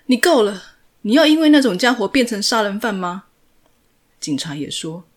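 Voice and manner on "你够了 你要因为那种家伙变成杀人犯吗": higher, much louder, anxious and furious; angry shout. A narrator's reading. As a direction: scold him at full volume. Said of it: "又急又气的大喊着". (又急又气地大喊)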